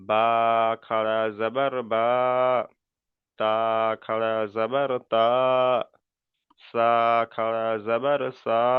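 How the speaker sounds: each final vowel in "আ, বা, তা" held long and level; drawn-out letter drill. Shown as a demonstration. Teacher showing khara zabar to pupils, "আ" drawn out. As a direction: stretch "জিম" and "দাল", hold the closing "জা", "দা". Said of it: "টান কিন্তু এক আলিফ পরিমাণ করে".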